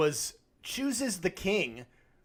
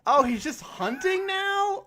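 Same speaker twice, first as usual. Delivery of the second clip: high, slow, puzzled question; baffled realisation; 13 semitones higher, slower than usual. Retold or imitated. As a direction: act like it finally clicks. Realising the king is hunting.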